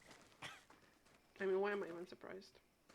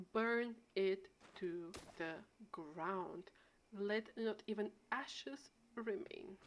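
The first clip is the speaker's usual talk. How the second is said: very slow, solemn poetic pronouncement; mock poetical; much slower than usual. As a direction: pause dramatically between phrases, like grand verse. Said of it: "I'm getting poetical".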